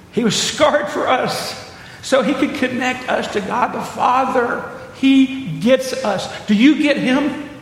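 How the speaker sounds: rising fervent sermon voice; preaching at full force. Acted. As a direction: press the point home to the congregation. Preaching climax to congregation.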